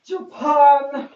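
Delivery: loud, high-pitched exclamation; playful shout. Pretend. Vlogger suddenly calling out a country, playful shout.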